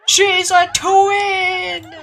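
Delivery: high, drawn-out laughing shout; crazed laughing cry. Acted. Yoshimura's crying turned to laughter, manic shout. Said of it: "crying somehow changes into laughter".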